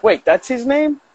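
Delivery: retold surprised question. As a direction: question it in drawn-out disbelief.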